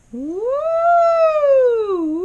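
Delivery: loud woo whooping up high into head voice. Shown as a demonstration. Teacher demonstrates the roller coaster reaching the top of the voice. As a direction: shoot the sound up into your head. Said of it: "shoot that sound right up into our head".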